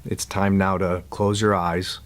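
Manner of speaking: calm guide with long silences between instructions; guided meditation. Real, not acted. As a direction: ease them into letting go, unhurried.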